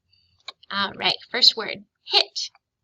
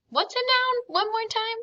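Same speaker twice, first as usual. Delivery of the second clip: much higher, sing-song teacher prompt; playful quiz voice; 13 semitones higher than usual. Acted. Teacher quizzes the children on nouns in a high, playful voice. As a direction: perk up and quiz the kids brightly.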